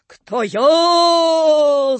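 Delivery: loud, high, long-held ghost wail; terrible howl. A narrator's reading. Stranger answers Napoleon, terrible loud howl. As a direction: howl it out at full length. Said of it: "завыл незнакомец ужасным воем".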